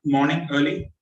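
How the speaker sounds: ordinary talk, nothing marked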